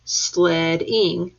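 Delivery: "sledding" broken into sled, ing; syllable by syllable. Shown as a demonstration. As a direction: separate the ending of "sledding". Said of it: "that had two parts".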